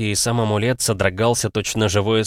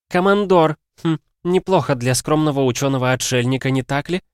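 sarcastic higher woman's voice; snide; 7 semitones higher, 3 dB louder than usual. Acted. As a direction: needle him sarcastically. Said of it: "съязвила Стайни".

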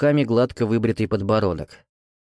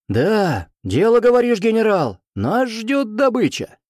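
higher, eager soldier's cheer; excited officer; 10 semitones higher, 3 dB louder than usual. Acted. Officers cheer the general's plan.

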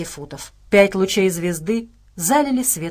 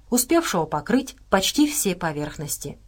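plain reading voice

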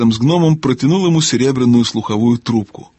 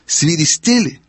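higher-pitched puzzled question; surprised gnome; 5 semitones higher, slower than usual. A narrator's reading. Gnome mishearing the owl's answer, surprised. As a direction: echo it in astonishment. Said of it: "удивился гном".

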